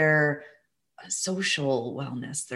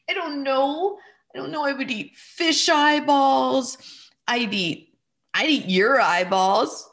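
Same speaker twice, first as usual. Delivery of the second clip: little boy, much higher, half crying and half playing along; child's voice; 8 semitones higher than usual. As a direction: voice the small son as he starts to joke. Son's voice in retold dialogue.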